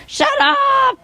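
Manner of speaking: very high, drawn-out mock squawk; parrot scolding dog. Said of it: "the dog too noisy".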